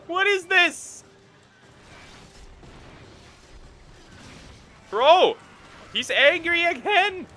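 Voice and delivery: high, loud frustrated yell; angry shout. Real, not acted. Demanding the ear from the monster, angry shout. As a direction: scream at the monster.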